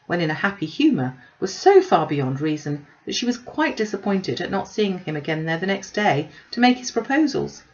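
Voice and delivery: plain reading voice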